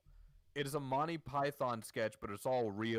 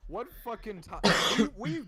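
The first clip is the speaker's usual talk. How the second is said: loud, higher, strained; exasperated outburst; 9 semitones higher, 9 dB louder than usual. Real, not acted. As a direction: blurt it out in disbelief.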